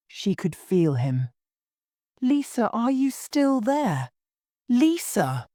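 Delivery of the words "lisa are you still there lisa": high-pitched calling out, no real volume; narrated shout. A narrator's reading. Amy calls down the phone to silent friend, shouting. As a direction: call anxiously, pitch lifted well up. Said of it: "shouted Amy".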